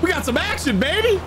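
very high hyped shout; hyped yell. Real, not acted. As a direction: whoop it up with glee. Hyped after a fight.

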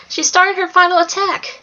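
high, urgent warning at moderate volume; restrained scream; mild scream. A narrator's reading. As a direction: cry out the alarm with pitch lifted. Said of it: "Alex screamed".

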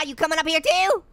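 ordinary delivery

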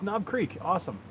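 normal talking voice

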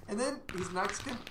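normal talking voice

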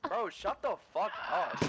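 usual speaking voice

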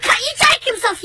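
high-pitched retold threat; angry threat. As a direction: threaten him in a heated rush of outrage. Threat to neighbour.

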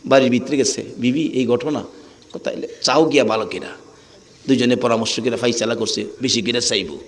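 low, soft private aside; hushed conferring. Acted.

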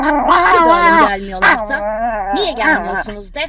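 high, loud, slow calls in the dog's voice; dog's voice shouting. Pretend. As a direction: speak for the dog, yelling at them. Voicing the dog shouting.

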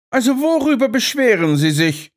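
mildly mocking question; Holmes teasing. A narrator's reading. Holmes unbothered. (Holmes spöttelnd, deutlich höher)

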